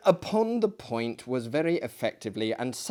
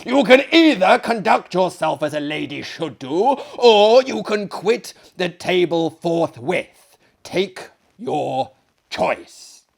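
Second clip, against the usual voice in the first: angry host; 4 semitones higher, 8 dB louder than usual. Acted. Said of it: "our host very angrily".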